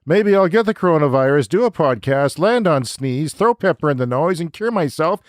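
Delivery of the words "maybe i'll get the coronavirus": normal talking voice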